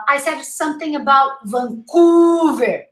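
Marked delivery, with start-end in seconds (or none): 1.4-2.8 Vancouver with a long stretched "oo"; Vancouver, weak first A, huge U; wrong pronunciation. Retold or imitated. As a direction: mispronounce Vancouver as vun-COOOO-ver. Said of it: "six U's".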